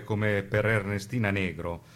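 ordinary talk

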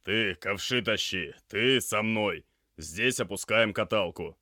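loud, firm commands to the crew; urgent orders, projected loudly; barked orders. Acted. Doctor giving urgent orders to crew.

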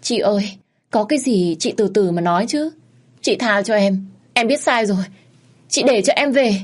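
high, rising frantic begging; girl begging the wife to stop, panicked and pleading; pleading in fear. Acted. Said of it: "vội vàng lắp bắp và van xin".

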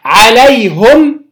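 عليهم said slowly "alayhum", u then bare m; second recited form. Shown as a demonstration. Teacher giving the second Quran reading, عليهم as "alayhum". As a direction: draw out عليهم, ending on "hum". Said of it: "وجه ثاني".

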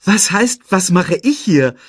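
high, loud incredulous echo; incredulous retort. A narrator's reading. Nala retorts to Simba, incredulous and loud. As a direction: throw the question straight back. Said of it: "entgegnete Nala".

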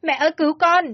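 loud, high cry for help; screamed plea. A narrator's reading. Girl begging her mother to save her, screaming. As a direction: call out desperately for mother at full voice. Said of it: "Tôi gào lên".

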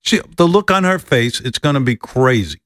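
usual speaking voice

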